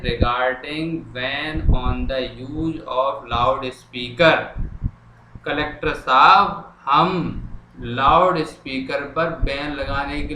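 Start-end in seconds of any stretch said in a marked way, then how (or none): 0.0-4.4 each word dictated slowly; slow dictation. Shown as a demonstration. Teacher dictating a letter line to students, slow and deliberate. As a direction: spell it out slowly for students copying. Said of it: "हमने लिख दिया है".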